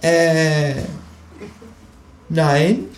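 high, hesitant kangaroo; a kangaroo caught out. Acted. Kangaroo voice in reading. (hohe, zögernde Känguru-Stimme)

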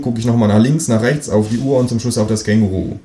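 ordinary delivery

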